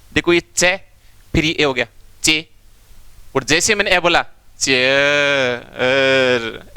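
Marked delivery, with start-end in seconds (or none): 3.4-6.7 चेयर said very slowly, the ए long and the r fading to almost nothing; faded r demo. Shown as a demonstration. Teacher showing students how the r of चेयर fades. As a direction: draw out चेयर so the r barely sounds. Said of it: "ऐसे लगा कि मैंने रे बोला है".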